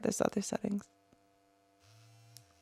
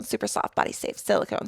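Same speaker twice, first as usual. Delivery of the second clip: whispered tongue twister; 5 dB quieter than usual. Shown as a demonstration. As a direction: whisper the phrase "Super soft, body safe silicone" with no voice. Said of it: "try saying that five times fast".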